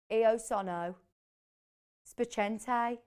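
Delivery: lightly imitated Brummie-accented Italian, "spiacente" ending like "eye"; a hint of Birmingham accent on the Italian. Host mimicking Paul's learnt Italian phrase, light Birmingham accent. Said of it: "Birmingham accent".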